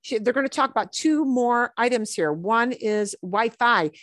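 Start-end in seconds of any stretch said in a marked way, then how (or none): none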